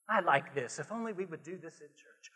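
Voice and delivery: ordinary delivery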